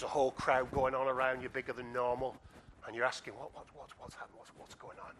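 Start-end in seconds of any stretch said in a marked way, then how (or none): 3.4-5.1 soft, hesitant repeated questions; half-whispered bewilderment; hushed confused questioning. Acted. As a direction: ask it under your breath, stumbling over the words. Imagined blind man asking, anxious.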